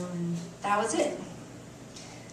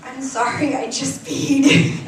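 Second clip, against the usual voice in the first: slow, louder, embarrassed admission; sheepish confession; 4 semitones higher, 9 dB louder, slower than usual. Retold or imitated. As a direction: confess it slowly, half laughing at yourself. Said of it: "and confessed".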